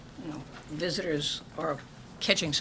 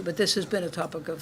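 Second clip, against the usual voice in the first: usual speaking voice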